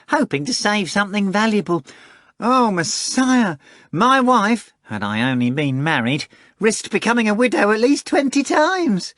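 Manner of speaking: high, loud wheedling cat voice; a fat cat bragging and wheedling; boastful cat Behemoth. Acted. Behemoth swearing to Voland, high wheedling cat voice.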